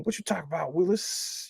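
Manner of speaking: hushed, rising Arnold catchphrase; half-whispered kid sitcom catchphrase; imitating Arnold. Streamer softly mimics Arnold for chat. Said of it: "I wish I could sound just like him".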